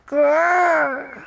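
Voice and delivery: put-on playful kid's growl; dinosaur growl. [name] plays a dinosaur.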